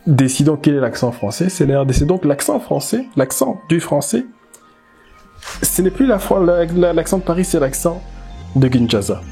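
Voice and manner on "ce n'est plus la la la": ordinary delivery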